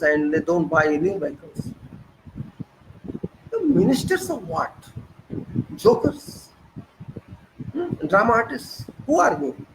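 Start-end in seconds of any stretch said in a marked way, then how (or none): 3.5-9.6 scornful mockery. Real, not acted. Mocking ministers.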